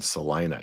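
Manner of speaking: "Salina" said sa-LYE-na, the middle like "lie"; Texan Salina. Shown as a demonstration. Co-host correcting the host's pronunciation, saying "Salina" as sa-LYE-na. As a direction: lean on the LYE in "Salina", low and settled. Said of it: "You wanna sound like a Texan".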